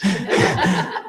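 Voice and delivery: a short, bright laugh at his own joke about the robes; chuckle, then let it fall away; happy laugh. Real, not acted. Speaker amused by the graduation robes, breaking into a light laugh.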